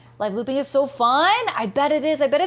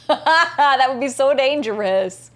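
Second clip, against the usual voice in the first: laughing, loud and drawn-out; real laughter; 2 semitones higher, 5 dB louder, slower than usual. Real, not acted. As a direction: laugh your way into a slow exclamation. Laughing at chat's risky suggestion.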